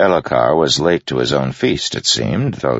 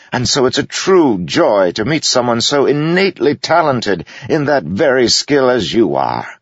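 high, louder sneering courtesy; sweet-sounding insult, pitched up high; mocking jester voice; 12 semitones higher, 4 dB louder than usual. A narrator's reading. Jester mocks the general at the feast, high and sneering.

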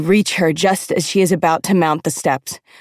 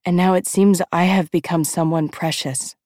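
usual speaking voice

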